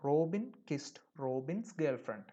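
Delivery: very slow, word by word; slow example reading. Shown as a demonstration. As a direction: read the example slowly and clearly. Teacher quotes the example again. (പതുക്കെ വ്യക്തമായി വായിക്കുന്നു)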